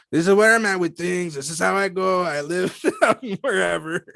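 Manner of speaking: lightly voiced Chicano accent. Shown as a demonstration. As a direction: show off the family accent casually. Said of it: "I'm really good at doing my accent".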